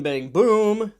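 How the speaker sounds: ordinary talk, nothing marked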